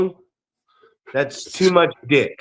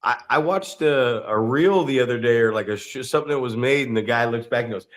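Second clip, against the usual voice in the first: ordinary talk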